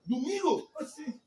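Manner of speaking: loud, high scolding; indignant preaching. Real, not acted. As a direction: scold the absent churchgoers. Scolding empty church.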